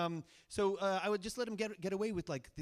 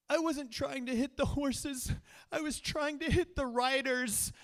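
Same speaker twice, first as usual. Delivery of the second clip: put-on higher, teary kid voice pleading innocent; imitating little brother; 8 semitones higher, 4 dB louder than usual. Seven-year-old brother explains himself to big brother, mock teary kid voice. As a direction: play the sniffling seven-year-old caught out.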